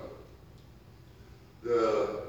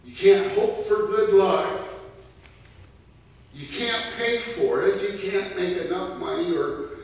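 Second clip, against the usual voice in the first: loud, rising preacher's call; fiery preaching; 7 semitones higher, 8 dB louder than usual. Acted. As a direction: preach it hard to the congregation. Preaching at full force.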